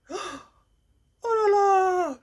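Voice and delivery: a high, loud cry of dismay. Real, not acted. Woman appalled by the buyer's jealousy, crying out in dismay. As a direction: let out a pained, exasperated exclamation.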